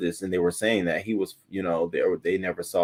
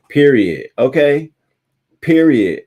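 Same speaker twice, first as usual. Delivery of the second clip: loud, higher, punching each word; emphatic declaration; 8 semitones higher, 12 dB louder, slower than usual. Real, not acted. Telling viewers she won't talk to staff, loud and final. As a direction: slam it shut with finality.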